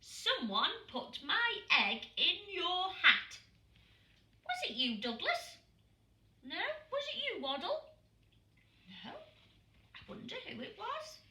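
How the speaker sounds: high, playful questioning; father hinting at the culprit; teasing dad penguin. A narrator's reading. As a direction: tease the chicks about the egg. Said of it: "said Mister Pilcher Brown".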